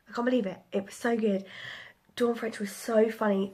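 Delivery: ordinary delivery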